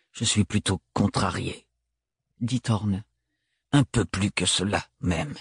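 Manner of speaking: homme froid, voix plus grave; voix de Thorne. A narrator's reading. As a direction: Thorne sec et distant, plus bas. Thorne voiced by narrator. (Thorne répond à Ophélie, voix grave et posée)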